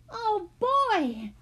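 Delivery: put-on high, awed little-boy exclamation; excited cry. A narrator's reading. Benny seeing the peacock, excited high cry. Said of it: "Benny cried".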